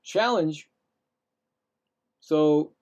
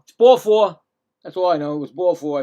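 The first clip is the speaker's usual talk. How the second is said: much louder declaration of ball four; loud, sure call; insistent; 8 dB louder than usual. Real, not acted. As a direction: announce it firmly, no doubt.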